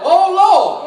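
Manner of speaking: high, drawn-out plea; retold crying out. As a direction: cry out as the blind men calling. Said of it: "they cried out".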